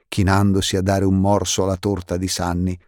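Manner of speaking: plain reading voice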